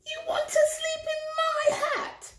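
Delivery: high squealing piglet, laughing in shock; horrified squeal. A narrator's reading. Hamilton the pig squealing at the mouse, high and horrified. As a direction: squeal it out, aghast. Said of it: "squealed Hamilton, horrified".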